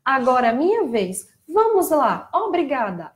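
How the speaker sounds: high, loud cartoon voice copied by a child; imitating Dora the Explorer. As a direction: sing it out like a cheery cartoon host. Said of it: "Ela repetia exatamente igual a Dora".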